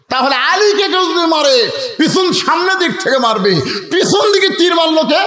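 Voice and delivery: high, insistent ringing questions; impassioned preaching. Real, not acted. Preacher asking who shot Ali from behind, high impassioned preaching. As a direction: cry the question out to the crowd.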